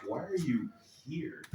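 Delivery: "are" leaned on with a sneering upward lilt; quiet mock-British incredulous question; disdainful why are you here. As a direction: ask "Why are you here?" as if nobody sane would come, stressing "are" with a rise. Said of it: "Or more like".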